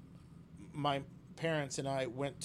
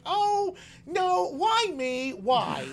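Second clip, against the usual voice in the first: high, loud, slow wail of why me; mock despair; 8 dB louder, much slower than usual. Man laments being grabbed, slow high mock wail. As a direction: ham up the self-pity. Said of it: "and I'm like".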